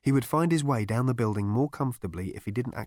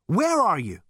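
loud, high-pitched alarm; panicky demand; 10 semitones higher, 6 dB louder than usual. A narrator's reading. Zaphod searching for the voice, panicky shout. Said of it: "panted Zaphod".

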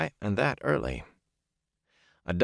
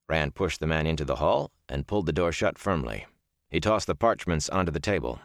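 ordinary delivery, nothing marked

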